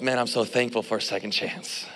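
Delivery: low, soft retold relief; grateful inner thought. Student spared by his professor, soft grateful thought. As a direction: think it aloud quietly, thankful. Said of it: "But I thought to myself".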